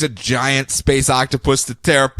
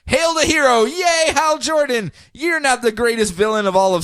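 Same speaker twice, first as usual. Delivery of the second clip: mock cheer; 9 semitones higher than usual.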